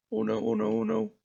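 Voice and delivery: high, soft whimpering oh no; a scared child under the covers; frightened little boy. Acted. Boy hiding under sheets.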